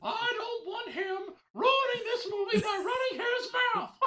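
high squeaky shouting cartoon mouse; Mickey Mouse voice. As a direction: yell it like a bossy Mickey. Continues Mickey voice.

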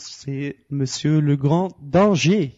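drawn-out punchline delivery; slow dramatic reveal. Real, not acted. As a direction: stretch the answer out slowly. Quiz host reveals the answer.

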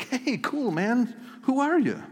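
high, breezy dude voice; a mock chill guy greeting the leper; casual townsfolk. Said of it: "nobody's like".

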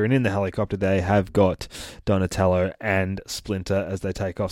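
ordinary delivery

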